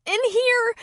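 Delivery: high loud call. A narrator's reading. Girl calling Kelly to the kitchen, loud. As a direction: shout back to be found.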